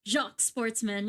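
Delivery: Jacques Sportsman with French zh; Jacques, zh and open ah. Shown as a demonstration. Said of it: "So it's like Jacques".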